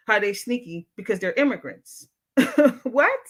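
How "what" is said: surprised laugh. Real, not acted. Laughing at a viewer comment.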